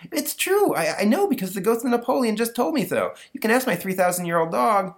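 put-on very high, loud kid insisting; insistent boy. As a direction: plead your case like a little boy. Stanley insists to his teacher.

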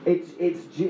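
ordinary talk, nothing marked

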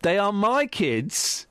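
very slow, dragged insistence; slow stubborn refrain. Acted. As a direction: drag each word out defiantly.

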